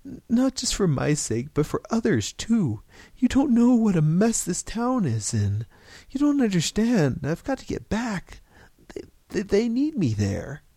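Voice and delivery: high, loud, stammering desperation; frantic, insistent plea; pleading. A narrator's reading. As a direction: beg urgently, tripping over the first words. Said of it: "George pleaded".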